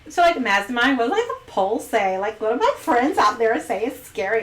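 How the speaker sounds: put-on bubbly Valley Girl, heavy on "like". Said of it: "Why did I just sound like a Valley Girl".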